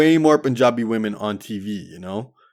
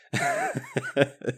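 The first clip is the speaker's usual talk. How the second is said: high laugh; 5 dB quieter than usual. Real, not acted. Laughs at own story.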